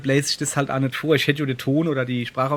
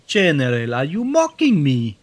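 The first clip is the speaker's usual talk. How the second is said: slow, louder mock general voice; imitating the general; 5 dB louder than usual. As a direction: echo the game's general, slowed down. Echoing game general's line. (General nachgeäfft)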